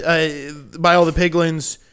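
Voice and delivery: ordinary talk, nothing marked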